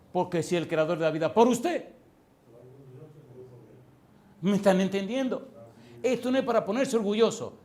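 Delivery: loud, high challenge to the congregation; full-force preaching. Real, not acted. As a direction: fire rhetorical questions at the crowd.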